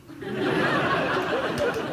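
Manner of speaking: high airy burst of laughter; unvoiced giggle, pitched high; breathy laugh. Real, not acted. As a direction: laugh at the memory. Laughs at finish line story.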